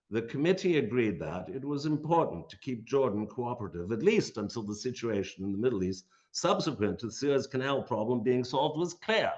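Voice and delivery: put-on lecturer reads the committee report in a light upper class Oxford accent. History lecturer quoting a British committee report, upper class Oxford accent. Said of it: "It just sounds so great in that upper class Oxford middle a-, uh, accent".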